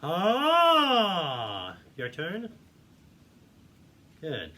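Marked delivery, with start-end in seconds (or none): 0.0-1.8 high loud open ah call; tenor yelp. Shown as a demonstration. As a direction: throw out a big bright ah. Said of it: "So just go".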